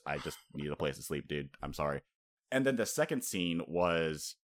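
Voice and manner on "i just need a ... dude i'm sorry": lower, softer mock apology; Zoro, low-key reply. Zoro answering Franky, low-key and soft. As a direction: drop low and quiet, imitating a weary excuse. Acting out Zoro's tired reply.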